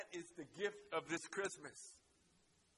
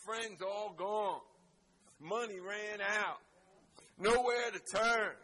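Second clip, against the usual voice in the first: the preacher testifies, building to a roaring shout; full-force preaching; 2 semitones higher, 6 dB louder than usual. Acted. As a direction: climb to a fiery preaching climax.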